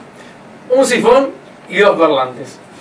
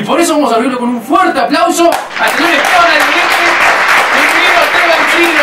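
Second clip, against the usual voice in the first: announcer hype; 7 semitones higher than usual. Acted. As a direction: whip up the crowd for the candidate. Presentador anuncia invitado. (presentador animando al público, fuerte y agudo)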